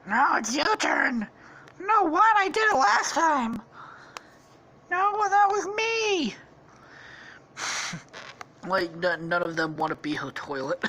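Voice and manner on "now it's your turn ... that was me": high, squeaky cartoon protests; bickering toads. Guards dodging the princess's demand, squeaky bickering. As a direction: squabble in squeaky, rising voices. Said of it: "look at each other like".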